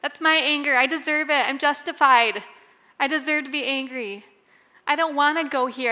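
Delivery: replaying her own angry reply to her husband; retold defiant anger. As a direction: push back hard, like a stubborn refusal. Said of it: "And I'm like".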